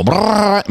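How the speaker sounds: rolled rattling brrrr; trilled machine burst; imitated drill noise.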